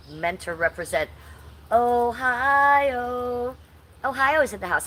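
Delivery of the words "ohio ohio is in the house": louder and higher, stretch out Ohio; big welcome shout for a chat from Ohio; hype shout-out. Real, not acted. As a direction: announce it like a crowd call. Shouting out a viewer's home state.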